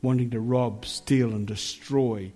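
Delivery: earnest spoken prayer to the Lord. Acted.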